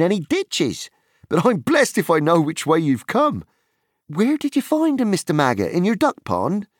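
high, bright-voiced Merry teasing; cheerful young hobbit. A narrator's reading. Merry greeting Frodo and teasing the farmer, high and lively. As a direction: chatter on with friendly relief.

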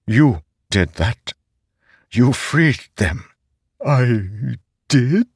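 a remorseful living sword; talking sword. Acted. Nightblood voice.

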